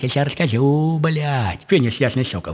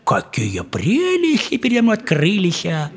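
higher, slower leering man's voice; lecherous man; 6 semitones higher, much slower than usual. Retold or imitated. Lecherous swan-man ogling the sleeping girl, leering and higher. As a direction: ogle her, drawn out and higher. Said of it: "говорит".

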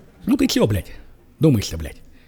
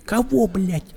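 ordinary delivery

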